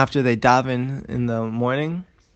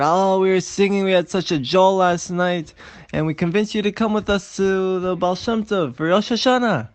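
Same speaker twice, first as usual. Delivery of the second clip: higher, sing-song enthusiasm; merry excitement; 7 semitones higher than usual. Acted. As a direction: gush about the great night out. Merry companions recount last night.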